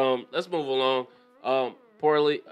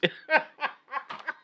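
a high burst of laughter; real high laugh. Real, not acted. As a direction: crack up at the insult. Laughing at the insult.

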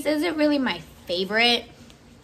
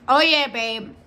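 normal talking voice

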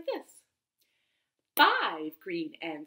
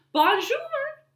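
high, bright sing-song bonjour; cheerful greeting; 6 dB louder than usual. Acted. Zookeeper's son greets the lion in a high cheery voice.

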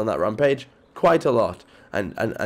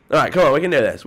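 usual speaking voice

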